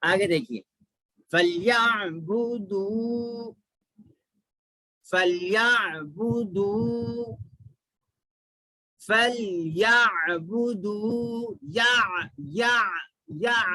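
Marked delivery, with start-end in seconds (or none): 1.3-11.6 فَلْيَعْبُدُوا slow, ain sounded from the throat. Shown as a demonstration. Teacher models فَلْيَعْبُدُوا for students, throaty ain, slow. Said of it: "عین کی آواز".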